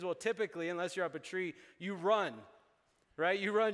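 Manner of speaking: normal talking voice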